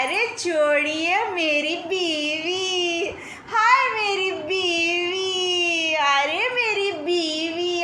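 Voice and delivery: slow mock crying over his wife; hammed-up wail. Mocking the dreamer, he wails slowly for his wife. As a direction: wail theatrically, dragging each word.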